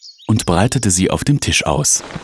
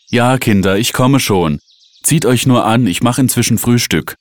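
higher, lightly voiced mother; mother calling back; 6 semitones higher than usual. A narrator's reading. Mother answering the children, calling back lightly. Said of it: "gab Mutter zurück".

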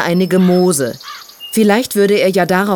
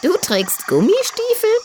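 high, amazed piglet question; astonished; 13 semitones higher than usual. Acted. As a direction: sound baffled, high pitch. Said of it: "fragte Tütü verblüfft".

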